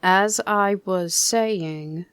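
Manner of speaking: loud, slow, stern. Acted. Eeyore to the noisy guests, loud and stern. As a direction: Eeyore reclaiming his speech. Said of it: "said Eeyore loudly and sternly".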